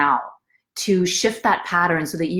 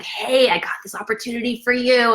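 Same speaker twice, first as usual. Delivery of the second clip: excited guy; 5 semitones higher than usual. Retold or imitated. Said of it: "he was so excited".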